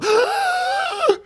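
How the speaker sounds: sharp high gasp of fright; mom's startled gasp. Retold or imitated. Streamer imitating his mom startled in the room, a high gasp. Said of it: "she'll go".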